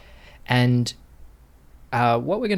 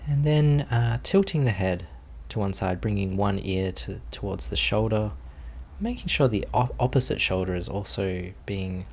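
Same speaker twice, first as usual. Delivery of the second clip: guided meditation. Acted. Teacher settling students into posture, calm guided meditation voice. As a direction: ease the group gently into stillness.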